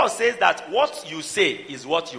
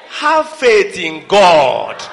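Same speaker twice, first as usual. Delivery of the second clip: slow, loud preached command; preached shout; 8 dB louder, much slower than usual. Acted. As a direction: hammer it home to the church. Preacher urging congregation.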